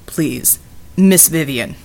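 low, patient big man; calm repeated request, low; deep polite voice. Acted. Bulwark repeats the plea.

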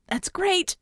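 high, strained gasp; short of breath, pitched high. A narrator's reading. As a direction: gasp the words out. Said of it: "Red gasped".

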